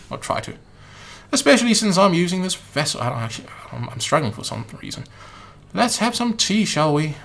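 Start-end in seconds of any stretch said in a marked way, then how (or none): 5.7-7.2 put-on a rough try at a British accent, lightly pitched up; failed British accent. Player attempting a British accent for Umbrella Man, badly. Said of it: "that's not an English voice".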